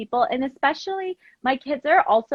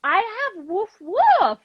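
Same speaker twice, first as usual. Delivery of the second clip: imitating nail lady; 9 semitones higher, 3 dB louder, slower than usual. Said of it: "And she was like".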